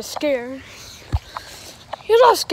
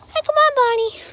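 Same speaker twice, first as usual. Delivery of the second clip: very high, squeaky friend voice; Chica character; 10 semitones higher, faster than usual. Pretend. Chica urges Bonnie on, high squeaky voice. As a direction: pitch it way up like a little cartoon friend. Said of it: "Chica, um, is gonna vlog".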